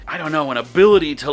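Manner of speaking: ordinary delivery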